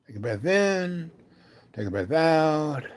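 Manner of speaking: gently sustained instructor cue; guided breathing. Retold or imitated. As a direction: coach someone through a breath, stretching "in" and "out". Coaching her through breathing.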